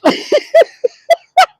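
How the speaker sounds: loud high-pitched giggle; high squealing laugh. Real, not acted. Laughing at own joke.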